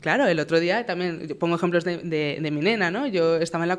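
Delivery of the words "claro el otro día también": ordinary talk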